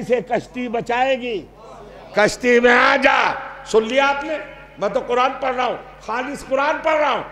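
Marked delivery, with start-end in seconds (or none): none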